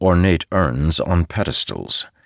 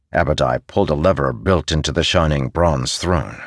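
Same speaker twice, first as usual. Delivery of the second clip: usual speaking voice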